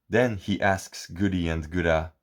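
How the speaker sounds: plain reading voice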